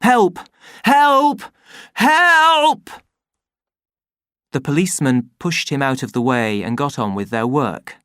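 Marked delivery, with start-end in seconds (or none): 0.0-2.9 building from a loud call to a high, loud yell; panicked escalating shouts for help; shouting louder each time. Acted. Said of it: "He shouted help several times more and more loudly".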